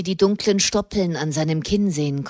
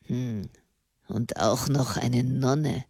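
grate it out under your breath; grinding out the words; 8 semitones lower, 7 dB quieter than usual. A narrator's reading. Prisoner seeing a nun in his cell, grinding scorn. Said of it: "knirschte er".

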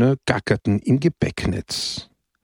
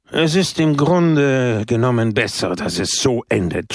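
mild mock shout. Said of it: "schrie Pepone".

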